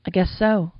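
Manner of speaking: normal talking voice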